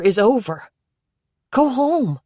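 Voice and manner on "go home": exasperated shout. Acted. Jacob shouts at deluded boy.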